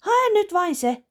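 high, loud little command; girl impatiently orders father, high and loud; commanding child. Acted. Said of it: "Iina komentaa".

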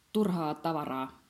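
slow, measured stranger's answer; a hint of a strange collector; lightly voiced stranger. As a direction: drawl it out like a mysterious visitor.

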